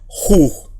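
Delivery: "ju" as a guttural j, oo, then throaty j; rough breathing. Shown as a demonstration. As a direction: say "ju" with a throaty Spanish j at both ends. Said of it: "la J que es J".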